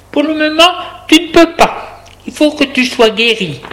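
very high, emphatic woman's voice; insistent carer. A narrator's reading. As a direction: firmly refuse the patient, pitched high. Woman forbids him going out. (soignante insistante, voix très haute)

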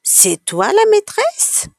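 slow, very high-pitched disbelief; astonished question. A narrator's reading. Child asking a man if he's the teacher, astonished. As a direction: ask it wide-eyed, drawn out and way up high. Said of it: "Apolline ouvre des yeux ronds".

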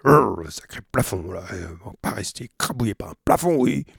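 grognement rageur du timonier, débit rapide; rage de marin. Acted. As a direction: peste contre ce fichu plafond. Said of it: "se remit au travail avec rage". (Penellan peste contre le plafond, voix rageuse)

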